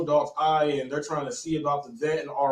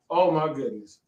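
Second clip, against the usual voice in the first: normal talking voice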